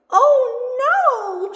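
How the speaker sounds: very high, loud, stretched cry; high wail of alarm; alarmed shout. A narrator's reading. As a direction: cry out in dismay at an accident. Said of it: "shouts the little spirit doll".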